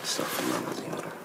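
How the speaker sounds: low, soft and quick under the breath; muttered prayer. Acted. Husband muttering forgiveness to himself, quick and hushed. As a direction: mutter it fast to yourself.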